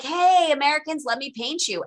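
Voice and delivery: mock hail, high and loud at first; lightly acted eager pitch to a group; eager painter hailing. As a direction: call out a breezy offer, easing off. Voices painter hailing the Americans.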